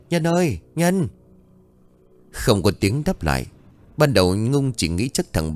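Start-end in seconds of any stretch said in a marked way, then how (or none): 0.1-1.1 high calling voice, searching for her son. A narrator's reading. Mother calling her son in the dark, calling out. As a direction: call out for the missing boy. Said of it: "Nhung cất tiếng gọi".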